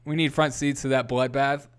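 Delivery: ordinary delivery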